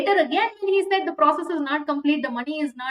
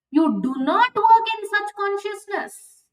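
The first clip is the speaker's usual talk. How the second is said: ordinary talk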